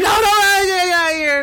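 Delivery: high, loud hammed-up panic; mock-panicked scream at haunted house actors. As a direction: shriek like the monsters are right there. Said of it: "I'm like".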